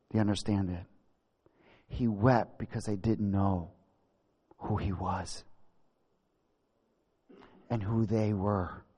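low, soft, weighty pauses; hushed and solemn. Real, not acted. Preacher explaining to congregation why Jesus wept, hushed solemnity. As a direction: let it sink in quietly, phrase by phrase.

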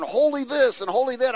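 high, loud excited biker; excited cussing biker. Retold or imitated. Preacher imitating a cussing biker friend, loud and high. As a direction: blurt it out like a rowdy old friend. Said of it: "he's going".

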